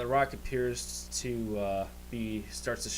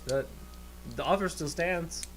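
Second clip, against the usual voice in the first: put-on higher-pitched old barkeep voice; apologetic tavern keeper; 6 semitones higher than usual. Tavern keeper repeats offer after dentures fall out, apologetic. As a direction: renew the offer in a lifted character voice. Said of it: "back in his mouth and goes".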